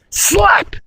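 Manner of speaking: a sharp high-pitched slap; high slap sound. Acted. Storyteller voicing a slap, high. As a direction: squeak the word slap up high.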